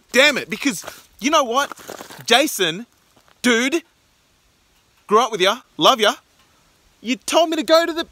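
frustrated rant. Real, not acted. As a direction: vent at Jason, pitch climbing. Frustrated rant at friend Jason.

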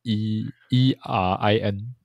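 E R I N spelled slowly letter by letter. Shown as a demonstration. Host spells the name E R I N slowly for co-host. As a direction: spell E R I N out slow and careful. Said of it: "我有点不太确定他重音在哪".

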